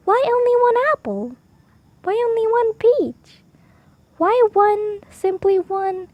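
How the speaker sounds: high, lilting, insistent questioning; Peggotty's insistent voice. A narrator's reading. Peggotty pestering Oliver about his single things, insistent high voice. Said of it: "repeat the same speech".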